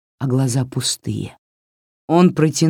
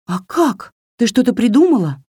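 put-on high, quick girl's voice; high-pitched, hurried question; 9 semitones higher, 3 dB louder than usual. A narrator's reading. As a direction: ask eagerly in a high voice. Varya asks doll anxiously.

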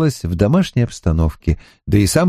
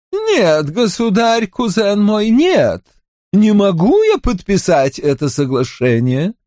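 countess voice; 9 semitones higher than usual. Acted. Mahaut refuses.

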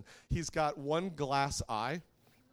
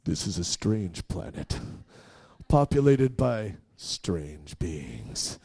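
lower, slow movie-trailer narration; sci-fi movie voice; 10 semitones lower, 6 dB louder, much slower than usual. Pretend. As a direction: intone it like a sci-fi film opener, very slowly. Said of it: "like one of those sci-fi movies".

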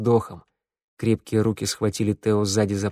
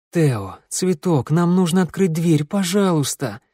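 higher, urgent plea; pleading; 6 semitones higher than usual. Acted. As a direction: beg him to open the door. Sanda pleads with Theo.

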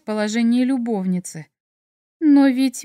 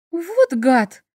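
higher, sharp indignant cry; indignant outburst; 6 semitones higher than usual. A narrator's reading. Heroine learns Mik married them, indignant outburst. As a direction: spit it out, outraged at Mik. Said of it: "возмутилась я".

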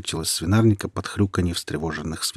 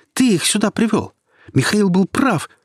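high, loud accusing cry; furious scream; 14 semitones higher, 6 dB louder than usual. A narrator's reading. Nika accuses Quentin, high furious scream. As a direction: hurl the blame at him. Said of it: "закричала она".

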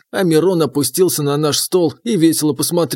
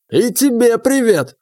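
higher, slow gap-toothed voice; gap-toothed tavern woman; 7 semitones higher, slower than usual. Acted. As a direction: play the brash gap-toothed barmaid.

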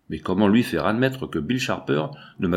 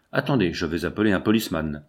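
ordinary delivery, nothing marked

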